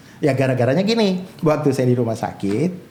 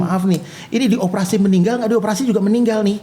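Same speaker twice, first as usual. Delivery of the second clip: raised pitch, retold plain-spoken doctor; blunt doctor; 6 semitones higher than usual. Doctor telling the mother surgery may fail, blunt. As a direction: give the grim odds like a frank doctor. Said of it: "Ngomongnya terus terang lagi dokter".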